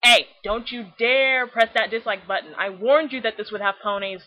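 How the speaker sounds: mock scolding. Real, not acted. To viewers reaching for dislike, loud mock scolding. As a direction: wag a finger at the viewers. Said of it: "scolding viewers about dislike button".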